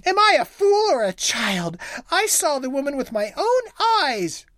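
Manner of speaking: high, rising wife voice; a hint of an indignant wife; wife insisting to her husband, high; angry wife. A narrator's reading. Wife in rage.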